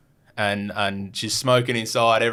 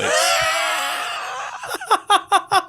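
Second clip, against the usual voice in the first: long, very high squealing laugh; real laughter. Real, not acted. Storyteller cracks up over the Crazy Horse reveal, laughing hard. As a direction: crack up helplessly.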